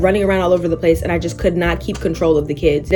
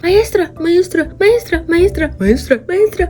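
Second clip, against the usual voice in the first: high, drawn-out maestra calls; kids calling maestra; 9 semitones higher than usual. Retold or imitated. Spanish-speaking kids calling the substitute, high and slow. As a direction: call maestra like a clingy kid. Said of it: "they speak Spanish".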